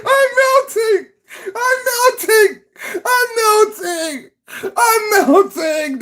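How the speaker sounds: mock the melting witch with a crying wail; Wicked Witch melting. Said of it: "It's like the Wicked Witch".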